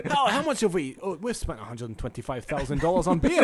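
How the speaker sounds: laughing through a put-on Scottish accent; a Scottish official giggling over the beer bill. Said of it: "You're pretty good at that accent".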